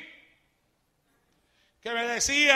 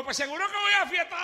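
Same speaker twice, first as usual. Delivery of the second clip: higher, louder, fast taunt with a laugh; mocking back; 8 semitones higher, 4 dB louder, faster than usual. Preacher taunts the mocker back, high, fast and laughing. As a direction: turn the laugh on him.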